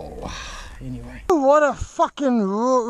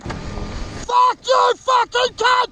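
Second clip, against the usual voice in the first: high shouted curses; swearing tirade; 10 semitones higher, 4 dB louder, slower than usual. Real, not acted. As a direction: bellow the curses, strained and high. Shouting curses while struggling.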